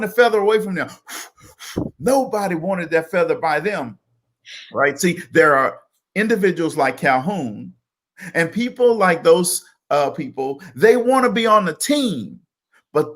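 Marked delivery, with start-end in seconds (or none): none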